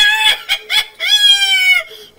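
high, loud laughing cheer; victory laugh; triumphant laughing shout. Real, not acted. Unlocked the shark.

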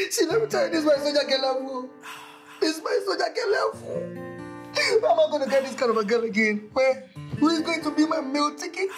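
tearful, wailing complaint; lamenting. Acted. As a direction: melodramatic heartbroken whine. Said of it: "you need to stop lamenting".

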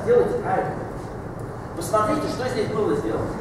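normal talking voice

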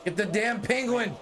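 high, urgent yelling; frantic yelling. Real, not acted. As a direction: yell at your team in panic. Team losing, yelling at screen.

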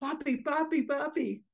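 put-on high, urgent little-child calls; small voice pestering a sleeper; children waking father. Children waking their papi after fleeing the cat, urgent. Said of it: "waking them up".

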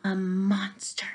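hushed, fearful whisper; scared whisper. Acted. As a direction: breathe it out in fright. Gerald guesses, scared whisper.

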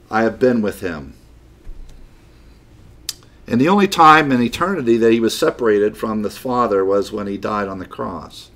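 ordinary delivery

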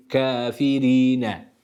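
Kafirine very slow, ri with a pure i; drawn-out Kafirine, pure ri; clear i on ra. Shown as a demonstration. Tutor models the kesra on ra. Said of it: "İ".

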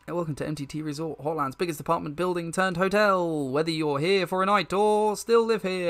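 peppy front-desk patter; hotel receptionist. Acted. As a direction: rattle off a chirpy hotel greeting.

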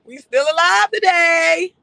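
high, loud, slow fake sobbing; mock crying wail. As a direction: ham up a tearful cry of survival. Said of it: "Who Kim said".

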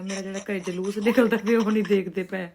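normal talking voice

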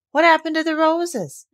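high, eager question; lifted, curious child's question; eagerly interested. A narrator's reading. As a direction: ask it with keen interest, pitched well up. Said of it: "more interested than ever".

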